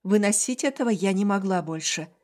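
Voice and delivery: ordinary delivery, nothing marked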